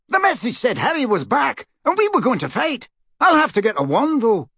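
high-pitched, eager character voice; high, animated protest; keen Dean. Acted. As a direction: insist you came to fight.

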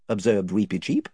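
plain reading voice

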